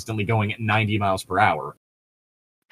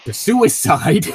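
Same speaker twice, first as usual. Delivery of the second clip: higher, louder, slowed-down dramatic punchline; mock dramatic; 10 semitones higher, 6 dB louder, slower than usual. Telling friend the dark origin of Arachnes, mock dramatic. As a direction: land the grim twist with a theatrical swoop.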